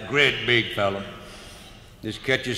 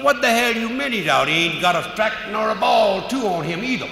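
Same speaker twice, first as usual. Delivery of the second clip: angry protest; 9 semitones higher, 5 dB louder than usual. Retold or imitated. Manager arguing with the umpire.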